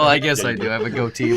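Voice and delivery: normal talking voice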